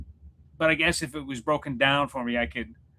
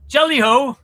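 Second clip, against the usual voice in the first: loud, high mock British call; horrible British accent; 10 semitones higher, 10 dB louder than usual. Greeting a viewer from the UK, hammed-up British accent. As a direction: holler it in a bad British accent. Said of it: "my horrible British accent".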